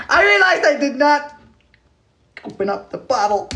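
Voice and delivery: squeaky high repeat; high, goofy self-mockery; silly high voice. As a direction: say it in a playful falsetto. Repeats own line in silly voice.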